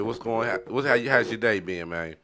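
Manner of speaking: ordinary delivery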